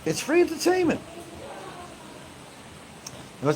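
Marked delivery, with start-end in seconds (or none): 0.0-1.0 high mocking repeat of the line; high-pitched mocking echo. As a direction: toss it back in a much higher put-on voice.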